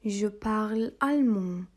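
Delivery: slow, clear French phrase; slow model phrase. Shown as a demonstration. Teacher to students, slow model phrase. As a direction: say it slowly for learners to copy. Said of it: "yeni diller ekliyoruz".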